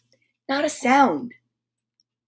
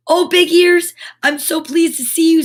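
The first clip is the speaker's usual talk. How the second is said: relieved; 4 semitones higher, 5 dB louder than usual. Acted. Noddy relieved to see friend.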